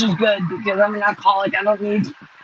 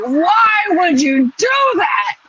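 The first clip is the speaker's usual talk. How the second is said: retold shout; 8 semitones higher, much slower than usual. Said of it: "He was like".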